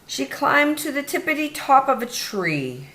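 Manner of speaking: plain reading voice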